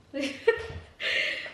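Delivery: short breathy giggle. Real, not acted. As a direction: laugh lightly at yourself. Laughs at her Superman pose.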